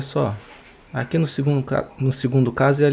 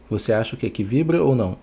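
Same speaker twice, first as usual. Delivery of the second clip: normal talking voice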